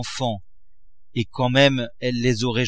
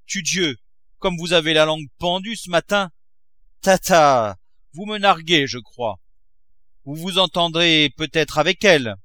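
sneering husband; 6 semitones higher, 5 dB louder than usual. A narrator's reading. Miser mocks his wife.

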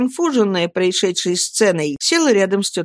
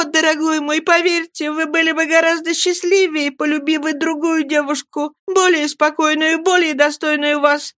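put-on tearful young girl; 9 semitones higher than usual. A narrator's reading. Girl weeping, pleading with fiancé.

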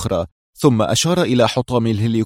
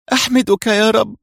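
high-pitched, emotional cry of gratitude; near-tearful thanks; 11 semitones higher than usual. A narrator's reading. Reham thanks God after surviving, near tears. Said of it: "كادت دموع ريهام تنفجر عندئذ كالبركان".

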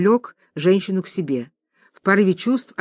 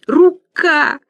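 a loud, high rasped cry of pain; hoarse gasp; 9 semitones higher, 5 dB louder than usual. A narrator's reading. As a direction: croak it out through the pain. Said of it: "хрипит Марусин". (хриплый вскрик от боли)

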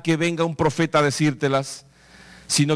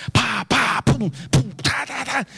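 voiced bones knocking, rising pitch; playful clattering noises; bone clatter sounds; 5 semitones higher, slower than usual. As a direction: mimic bones clacking together.

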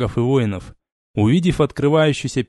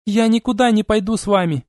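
crying out; 10 semitones higher than usual. Acted. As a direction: refuse them at full voice. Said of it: "вскричала она".

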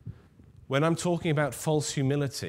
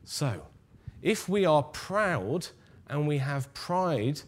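normal talking voice

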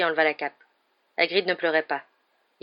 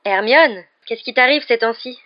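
loud, raised incredulous question; high, loud burst of disbelief; astonished exclamation; 5 semitones higher, 8 dB louder, slower than usual. A narrator's reading. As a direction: exclaim it in amazed reproach. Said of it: "s'exclama Ron".

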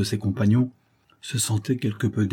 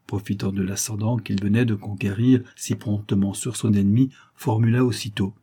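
ordinary delivery, nothing marked

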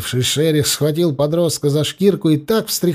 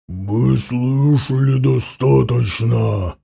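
slow, low growl; growling werewolf; 3 semitones lower, slower than usual. A narrator's reading. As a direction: growl it out like a beast. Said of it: "прорычал Шерих".

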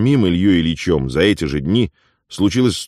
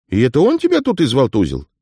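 Afonya's voice; 8 semitones higher than usual. Acted. Afonya questions beaten Ilya.

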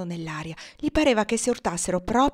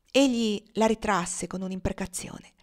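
plain reading voice

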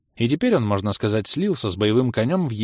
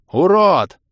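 высокий, очень громкий выкрик; злобно рявкнуть обидчику; ор в ответ; 8 semitones higher, 9 dB louder than usual. A narrator's reading. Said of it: "заорал я в ответ". (заорать на врага)

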